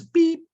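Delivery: sudden high-pitched beep; scanner beep. Retold or imitated. Father imitating the store scanner's beep, high and short. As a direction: chirp it like a checkout scanner.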